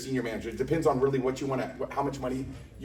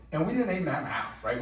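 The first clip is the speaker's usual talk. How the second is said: ordinary talk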